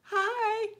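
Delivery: tiny high hi; squeaky hi. Real, not acted. Streamer interrupting herself to greet a viewer with a high hi. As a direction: break off for a high little hi.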